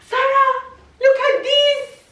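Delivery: high, excited call to her daughter; mother calls daughter to see gift, excited. Real, not acted. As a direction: call Sarah over, thrilled.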